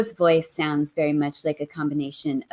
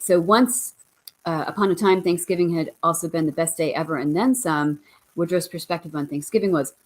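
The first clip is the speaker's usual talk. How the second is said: ordinary talk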